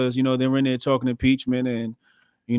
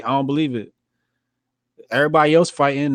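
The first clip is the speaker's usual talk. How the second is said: usual speaking voice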